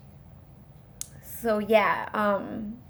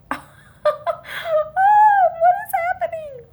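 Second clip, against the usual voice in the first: very high, loud, drawn-out wail; high-pitched mock cry of shock; acted shock; 7 dB louder, much slower than usual. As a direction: squeal it slowly in stunned disbelief. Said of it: "I was just shocked".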